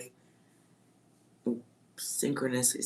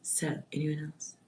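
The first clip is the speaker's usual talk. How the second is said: whisper; 10 dB quieter than usual. Real, not acted. Whispered question to viewers.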